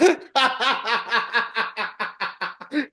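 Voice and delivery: squealing high laugh; high giggle. Real, not acted. Narrator laughing at the bit, high giggle. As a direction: crack up high.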